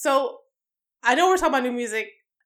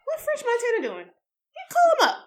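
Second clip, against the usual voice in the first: mock label exec; 10 semitones higher, 4 dB quieter than usual. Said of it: "it's like".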